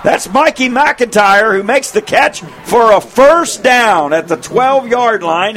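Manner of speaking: rising excited play-by-play, half shouted. Real, not acted. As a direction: build the call as the pass flies. Tense final drive call.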